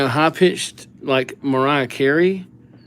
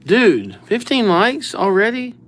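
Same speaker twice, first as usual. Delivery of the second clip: excited; 9 semitones higher, slower than usual. Real, not acted. Excited at the likes count.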